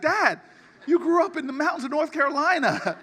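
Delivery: calling out the tall tale, half-laughing; incredulous retort. Retold or imitated. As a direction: son needling his father over a far-fetched story, incredulous. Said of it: "And I'm like".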